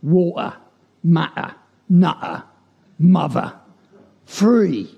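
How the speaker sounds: East London Cockney recitation; Cockney accent. Shown as a demonstration. Poet moving east from Bow Bells, Cockney accent. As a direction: drop into broad Cockney as the tone goes down. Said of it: "The moniker is Cockney".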